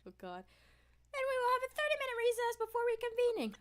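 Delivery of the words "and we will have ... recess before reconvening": higher, fast judge voice. Acted. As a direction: rattle off the ruling. Voicing the judge.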